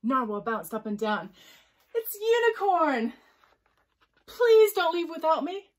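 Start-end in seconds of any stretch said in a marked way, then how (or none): 1.9-3.1 high, loud, stretched-out exclamation; thrilled storybook announcement; excited cry. A narrator's reading. Excited Narwhal spots Unicorn. 4.2-5.7 high, loud, slow pleading cry; desperate storybook call; panted plea. A narrator's reading. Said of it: "panted Unicorn".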